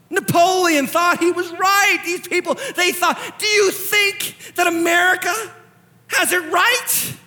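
high, impassioned preaching, stretched key words. Real, not acted. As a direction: drive each point home at high pitch. Preaches at full force.

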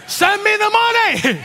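high, drawn-out joking holler; mock prosperity preacher. Preacher joking about preaching prosperity, hammed-up plea. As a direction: ham up a money plea, pitch way up.

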